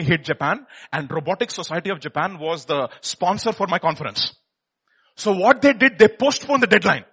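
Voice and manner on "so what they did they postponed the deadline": loud, eager reveal; excited. Real, not acted. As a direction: announce it with excitement.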